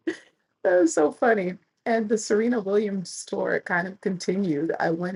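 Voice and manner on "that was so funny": high, slow laughing delight. Real, not acted. Laughing at the story, pitched high and slow.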